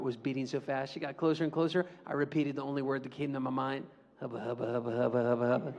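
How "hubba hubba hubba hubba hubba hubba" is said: nervous mumble. Retold or imitated. Nervous boy stammering at girl.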